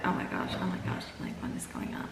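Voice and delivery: breathless frightened whisper; panicked whisper. Retold or imitated. Retold panic seeing ghost.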